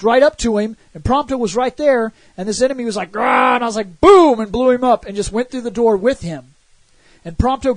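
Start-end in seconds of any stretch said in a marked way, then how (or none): 3.1-3.6 monster growl. Retold or imitated. Enemy attacking, voiced growl. 4.0-4.4 loud boom. Retold or imitated. Blasting the enemy in game.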